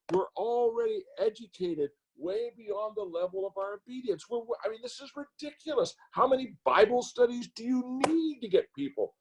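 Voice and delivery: high, exasperated rant; ranting and raving. Real, not acted. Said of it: "I'm ranting and raving".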